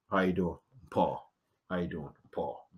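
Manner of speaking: low, hushed casual hello; soft low passing greeting; low quiet greeting. Retold or imitated. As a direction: nod past them murmuring.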